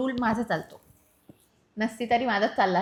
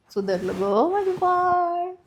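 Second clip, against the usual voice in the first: put-on high, drawn-out baby-talk cooing; लाडिक कौतुक; 7 semitones higher, much slower than usual. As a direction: coo it slowly, like doting on a baby. बायको नवऱ्याचं लाडाने कौतुक करते. (लाडात, बाळाशी बोलल्यासारखं)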